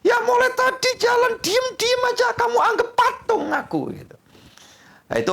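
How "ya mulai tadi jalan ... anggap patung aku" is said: high-pitched toothache sufferer's outcry; hammed-up indignant yell, pitched way up; mock yelling. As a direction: play a touchy patient scolding a child. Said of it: "Dia teriak".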